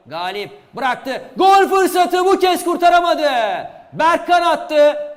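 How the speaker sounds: loud goal call with laughter; screaming football commentator. Real, not acted. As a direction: shout the goal joyfully. (coşkulu spiker)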